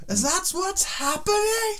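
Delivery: squeaky high outraged question; mock indignant. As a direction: play offended, pitch way up. Mock outrage about podcast dying.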